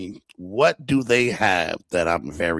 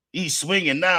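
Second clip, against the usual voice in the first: ordinary talk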